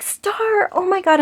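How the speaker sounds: put-on retelling her own thrilled reaction to the girl's name; excited squeal. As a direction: squeal it like you just got a sign. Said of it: "And I got so excited".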